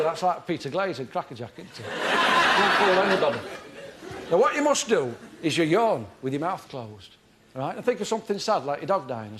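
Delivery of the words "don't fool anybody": ordinary talk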